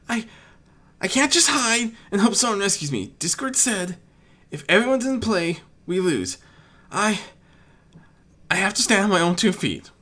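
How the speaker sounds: high small voiced dragon, gathering courage; young dragon Spike, scared. Spike alone in the ash, bracing himself. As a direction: talk yourself up, frightened but resolved.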